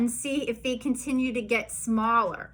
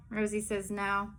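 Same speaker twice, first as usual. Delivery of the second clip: ordinary delivery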